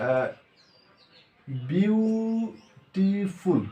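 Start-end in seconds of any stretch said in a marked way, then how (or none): 1.7-3.6 beautiful drawn out very slowly, syllable by syllable; slow teacher demo, beats counted clearly. Shown as a demonstration. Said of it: "উচ্চারণটা দাও".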